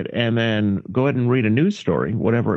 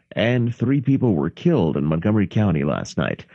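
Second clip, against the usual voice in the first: ordinary talk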